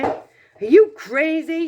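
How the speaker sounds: a woman shouts at the man offering to buy; shouting. Acted. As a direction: shout her down in disbelief. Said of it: "shouted a woman".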